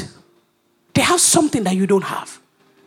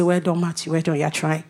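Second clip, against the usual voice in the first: indulgent Jesus; 7 semitones lower than usual. Retold or imitated. Jesus humoring Matthew.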